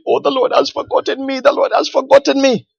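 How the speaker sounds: put-on high, pleading voice of a weeping old man; imitating crying old man. Preacher retells an old man crying in his arms, high tearful plea. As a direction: lightly mimic his tearful lament. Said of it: "he was crying".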